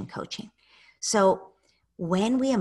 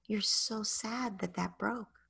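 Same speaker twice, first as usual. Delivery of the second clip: soft, slow and gentle; empathetic parent; 4 semitones higher, 7 dB quieter, slower than usual. Shown as a demonstration. Parent naming a child's sadness over a broken toy, soft and slow. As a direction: meet the child's sadness tenderly. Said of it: "what's appropriate is to say".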